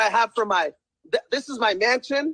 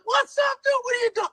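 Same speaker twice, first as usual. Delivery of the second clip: very high, shouted hello; excited greeting; 13 semitones higher than usual. Retold or imitated. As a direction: call out across the court. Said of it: "He's like".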